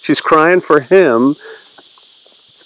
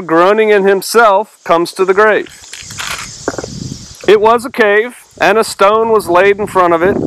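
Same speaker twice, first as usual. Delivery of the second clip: raised, measured Bible-reading voice; higher-pitched, deliberate recitation; scripture reading; 5 semitones higher than usual. Acted. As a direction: read the verse out to a study group. Reads scripture aloud.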